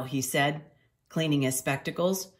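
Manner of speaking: ordinary delivery, nothing marked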